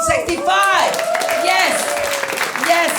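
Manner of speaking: high, loud whoop of pride; triumphant shout. Real, not acted. Comic celebrating her sixty-fifth birthday, shouting. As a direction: yell it like a victory.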